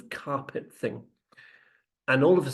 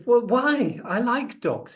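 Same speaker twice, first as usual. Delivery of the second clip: high-pitched, wounded protest; acted protest; 10 semitones higher, 5 dB louder, slower than usual. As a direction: protest innocence with the pitch jumping up. Rep protests his innocence.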